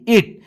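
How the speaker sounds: इट said high, starting with "ih"; short i in इट. Shown as a demonstration. Teacher correcting for students, short "ih" in इट. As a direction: say इट with a short i, never "eye". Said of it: "इसको आइट नहीं कहेंगे".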